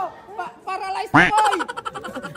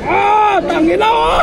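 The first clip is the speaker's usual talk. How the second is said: loud cursing yell; shouting; 4 semitones higher, 5 dB louder, slower than usual. Real, not acted. Ride yelling.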